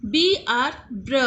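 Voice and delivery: ordinary talk, nothing marked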